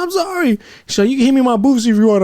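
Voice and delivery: put-on high, quick girly apology; imitating the girl. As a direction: chirp it out as a bubbly girl. Imitating the female training partner.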